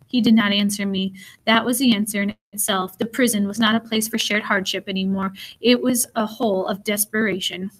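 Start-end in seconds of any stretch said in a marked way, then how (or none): none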